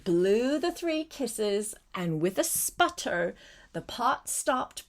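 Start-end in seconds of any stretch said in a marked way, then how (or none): none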